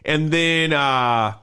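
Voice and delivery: ordinary talk, nothing marked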